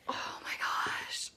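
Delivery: slow whispered gasp; whispering. Real, not acted. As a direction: breathe it out in a whisper. Whispered reaction to the mess.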